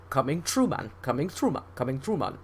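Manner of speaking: put-on light Indian-tinged Jamaican accent, softening; mock Jamaican lilt from an Indian cook. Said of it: "in an Indian Jamaican accent".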